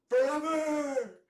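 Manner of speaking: huge, high, drawn-out yell of forever; team owner's rallying cry. Retold or imitated. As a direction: bellow it slowly like a triumphant owner. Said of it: "the owner of the Jaguars would say".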